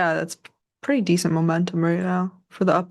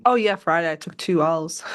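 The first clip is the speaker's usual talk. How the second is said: ordinary delivery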